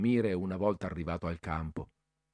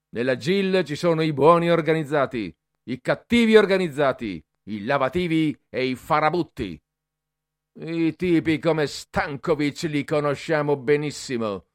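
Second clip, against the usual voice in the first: louder, higher stern speech; harsh lecture; 5 semitones higher, 6 dB louder than usual. A narrator's reading. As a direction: lay down the law. Cadet lecturing boys harshly. (Bertoli fa la predica ai ragazzi, duro e forte)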